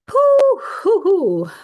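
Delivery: high loud hooting whoops; excited whooping. Real, not acted. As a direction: let out a few excited hoots. Excited whoop at date realization.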